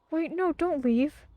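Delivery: high, loud gasping plea; surprised plea. Real, not acted. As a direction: gasp and beg it to stay. Bird flies away, gasps.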